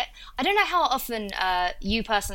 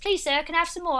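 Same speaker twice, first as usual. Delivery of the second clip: higher-pitched pleading urchin; Oliver Twist; 7 semitones higher than usual. Acted. Briton illustrates Cockney commoners, pleading higher-pitched orphan voice. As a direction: beg politely in a little Cockney voice. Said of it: "commoners like Oliver Twist".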